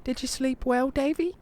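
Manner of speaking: very high English granny voice; confiding English old lady, pitched high; mock-whispered English accent, high and voiced; whispering English old lady. A narrator's reading. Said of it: "leans over to me and whispers".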